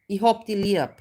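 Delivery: Austrian dialect, "habe" clipped to "hab". Shown as a demonstration. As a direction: chop the words Austrian style, "hab". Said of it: "It's just cut up".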